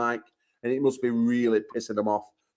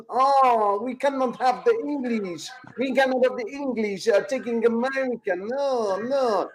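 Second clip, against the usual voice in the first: high mocking whiny voice, much higher; mock French whine; 10 semitones higher, slower than usual. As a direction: ham up a snooty Frenchman.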